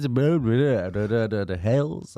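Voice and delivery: mock Welsh mumble. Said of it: "Welsh noise".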